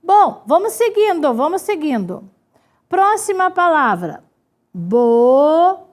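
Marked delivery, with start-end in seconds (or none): 4.7-5.9 "Bocaiúva" in long, separated syllables. Shown as a demonstration. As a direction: sound out "Bocaiúva" slowly as if writing it. Writing the word syllable by syllable.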